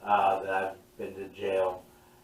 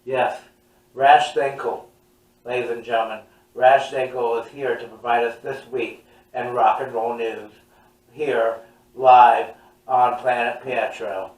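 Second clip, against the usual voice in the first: announcer voice; 5 dB louder than usual. Acted. Mock breaking news read.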